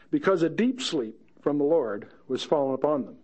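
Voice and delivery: ordinary delivery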